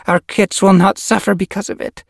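high, loud voiced character; cat character voice. Yellow Fang insisting.

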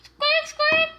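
loud, very high squeaks; piercing little bunny squeaking; chocolate bunny squeak. Pretend. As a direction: squeak loudly like a toy rabbit. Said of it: "the chocolate bunny is back".

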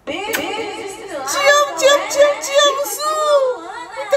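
high, drawn-out, tearful gushing; thrilled wail of thanks; overjoyed squealing. Real, not acted. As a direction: squeal your love, near tears with joy.

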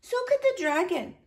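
put-on reply calmly like a little girl. A narrator's reading. Minuet calm reply.

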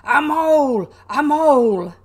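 very loud, high battle yell; full-force charging shout; shouted war cry. A narrator's reading. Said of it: "shouting his awful war cry".

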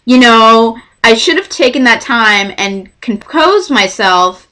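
ordinary delivery

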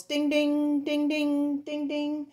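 high, loud ringing dings; playful bell imitation, bright and repeated; train bell. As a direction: ring it out like a bell. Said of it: "here's the bell".

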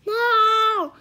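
loud, high cry of protest; shouted protest. A narrator's reading. Annie protesting at the knight's warning, shouting. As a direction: shout it out in alarm. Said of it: "shouted Annie".

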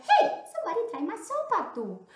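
high, loud mama bear. Acted. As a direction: pitch it high as an indignant mother bear. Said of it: "also mama said".

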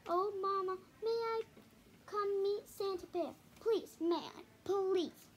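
high, loud begging cub; little cub begging loudly to meet Santa; excited pleading. A narrator's reading. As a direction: beg Mama eagerly. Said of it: "she cried".

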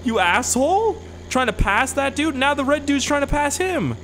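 high-pitched, incredulous rant; road rage. Real, not acted. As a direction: berate the idiot drivers. Still ranting at the drivers.

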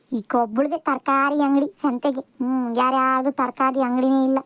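low-pitched grumble to oneself; low, disgruntled griping; grumbling. Acted. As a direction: complain under your breath about the seller. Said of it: "ಗೊಣ ಗೊಣ ಅನ್ನೋದ್ ಬಿಟ್ಟು".

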